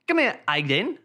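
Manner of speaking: mock British "Aiden" with no H; British-accented "here, Aiden", H left off; dropped H. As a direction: call "Aiden" without the H. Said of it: "Pronounce your H's".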